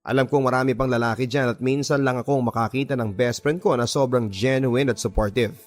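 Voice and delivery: usual speaking voice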